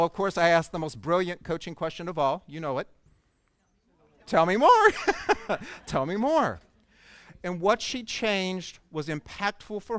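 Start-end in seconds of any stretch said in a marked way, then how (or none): 4.3-4.9 much higher and louder, a big comic "Tell me more"; punchline delivery. Retold or imitated. Speaker quoting his own coaching question to the audience, loud and high. 4.9-5.8 short unvoiced laugh between words; breathy laugh. Real, not acted. Speaker laughing at his own punchline, breathy.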